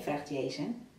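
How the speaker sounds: ordinary delivery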